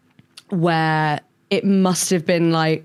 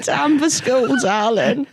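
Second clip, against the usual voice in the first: put-on high, crackly old crone; sing-song crone mum; 6 semitones higher, slower than usual. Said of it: "this crone voice that you give her".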